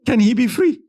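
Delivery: high, pleading wife of the king; a queen asks Salah ad-Din to free the king, high and pleading. Retold or imitated. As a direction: beg for her husband's release. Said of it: "came to him and said".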